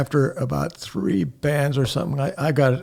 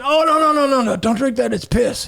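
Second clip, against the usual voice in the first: high, louder retold warning; urgent warning; 10 semitones higher, 4 dB louder than usual. Teller warns the stranger off the bottle, urgently. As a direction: rush to head them off, pitch raised.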